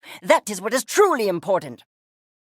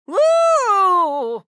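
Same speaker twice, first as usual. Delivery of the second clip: high, loud whoop; excited whoop; 10 semitones higher, 6 dB louder than usual. Acted. Excited cry on its own.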